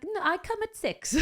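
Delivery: high, slow mock-Italian insistence; hammed-up Italian protest; bad Italian accent. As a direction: drag it out in a badly faked Italian accent. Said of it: "I can't do an Italian accent".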